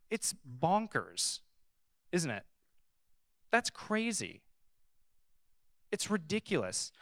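ordinary talk, nothing marked

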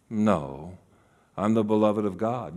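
low, soft, steady answer; quiet self-reminder. Acted. Jesus reminding himself who he is, quiet and low. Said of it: "he would have to remember".

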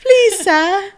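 high, loud pleading orphan; Oliver Twist begging. Acted. Sister plays Oliver Twist, high pleading. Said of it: "That's like Oliver Twist".

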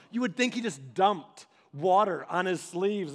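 ordinary talk, nothing marked

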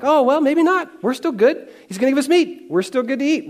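hammed-up naive relief; mock relieved Israelites. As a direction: play the gullible crowd, relieved. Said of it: "And you're like".

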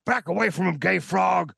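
high hoarse shouting rant; Alex Jones shout. Acted. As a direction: yell it like a furious radio host. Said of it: "He yelled".

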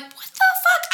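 shocked squeal. Retold or imitated. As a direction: shriek it in mock outrage. Retelling shock at grade.

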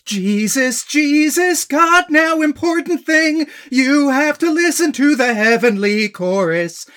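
loud, high, swelling mock grandeur; overblown heavenly chorus. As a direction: belt it out like a heavenly choir. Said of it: "tries way too hard to be like".

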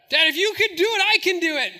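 put-on high, loud kid protest; imitated insistent son. Son pleads with dad to let him jump, insistent. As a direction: play a boy eager to prove himself.

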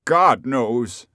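loud, exasperated exclamation; at wits' end. A narrator's reading. Rip answers who he is, at his wits' end. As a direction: throw it out helplessly. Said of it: "at his wits' end".

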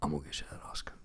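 soft whispered promise; whispered vow to Mama. Acted.

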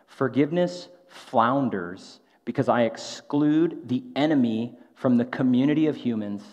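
slow, measured quotation; spaced-out, careful phrasing; slow and deliberate. Real, not acted. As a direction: read it slowly so it can be grasped. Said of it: "I'm gonna say this slowly".

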